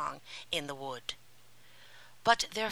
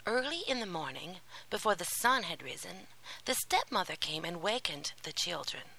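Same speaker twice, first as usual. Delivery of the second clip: ordinary delivery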